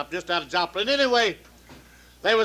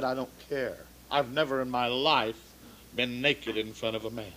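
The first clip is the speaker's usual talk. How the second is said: softer and lower, a shy shocked waitress; voiced waitress; 8 semitones lower, 8 dB quieter than usual. Waitress to the doctor, soft and low. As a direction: hush it like a prim patient refusing.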